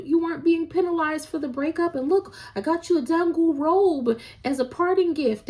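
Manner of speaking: high, far-off sugary voice for the king's excuse; mock smooth talker. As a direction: sweet-talk it, brushing off the breakup. Mocking a character's smooth talk.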